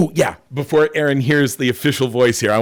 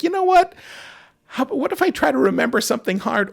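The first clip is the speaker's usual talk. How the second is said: acting out own choice; faster than usual. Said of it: "It's like".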